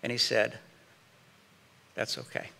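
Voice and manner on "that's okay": hushed, almost whispered; soft unvoiced "That's okay"; quiet reply. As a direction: say it gently under your breath. Husband answers wife softly.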